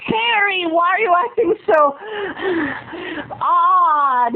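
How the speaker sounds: high mock whining question; imitating Laura. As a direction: whine it up high. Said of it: "Laura's voice continues".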